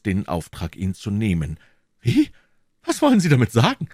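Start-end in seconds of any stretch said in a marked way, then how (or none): 2.0-3.9 high, fast startled queen; startled outrage. A narrator's reading. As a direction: gasp out the question in shock.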